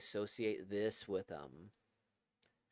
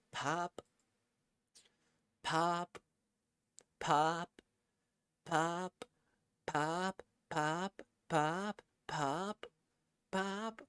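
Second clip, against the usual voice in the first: higher pitched little pops, one by one; voiced pop sounds; 6 semitones higher, much slower than usual. Narrator voices files deleting, light higher pops. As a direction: mouth each file popping away.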